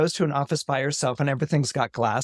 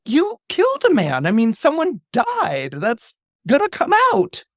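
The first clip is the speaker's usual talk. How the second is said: accusing; 12 semitones higher, 4 dB louder than usual. Retold or imitated. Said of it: "she's like".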